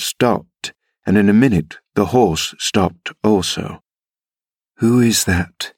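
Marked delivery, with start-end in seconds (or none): none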